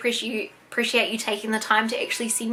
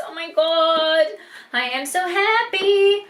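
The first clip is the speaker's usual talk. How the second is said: giddy gushing; 5 semitones higher, slower than usual. Real, not acted. Gushing over dolls together.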